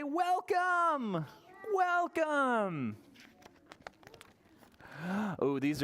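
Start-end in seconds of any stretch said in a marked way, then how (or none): 0.0-1.2 high, ringing welcome; welcoming host. Shown as a demonstration. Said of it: "Can you say". 1.7-3.0 high, falling welcome; welcoming host. Shown as a demonstration. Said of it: "Can you say".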